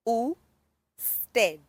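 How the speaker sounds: usted split into two drawn-out syllables; teacher's syllable-by-syllable usted; sounded out. Shown as a demonstration. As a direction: break usted slowly into u, then sted. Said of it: "sound it out a little bit better".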